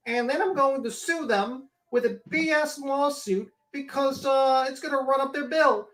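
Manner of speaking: whiny, overblown mimicry of someone vowing revenge; mocking impersonation. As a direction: play up the petty outrage, stretching the last word. Mocks litigious channel owner's threats.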